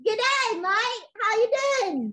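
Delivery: put-on a kid proudly copying the Australian greeting; child's try at Aussie slang. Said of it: "Could you say that for us?".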